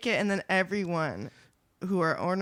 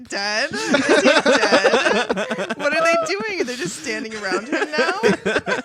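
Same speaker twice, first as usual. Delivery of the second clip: high, loud disbelief breaking into laughter; incredulous; 7 semitones higher, 7 dB louder than usual. Real, not acted. Listener baffled by the helpless private's fate, loud laughing disbelief. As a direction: question it in baffled, laughing outrage.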